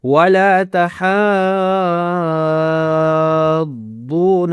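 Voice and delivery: ولا تحاضون with "haa" sustained extremely long; very long madd. Shown as a demonstration. Teacher demonstrating the long madd, ولا تحاضون slowly chanted. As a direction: hold the "haa" of ولا تحاضون, then join the heavy "daad". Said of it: "اس کو لمبا کرنا ہے".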